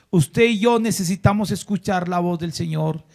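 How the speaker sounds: ordinary delivery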